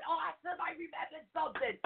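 high, pleased imitation of her own reply; mock delight. Telling Mom she remembered, she acts out high delight. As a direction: sound thrilled to remember something.

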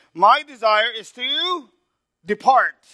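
drawn out high and loud, slow; preached verse. Shown as a demonstration. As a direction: lift the verse like a proclamation. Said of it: "I want you to see this".

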